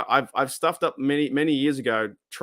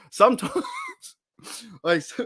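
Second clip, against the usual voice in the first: laughing; 7 semitones higher than usual. Real, not acted. Laughing at his own joke.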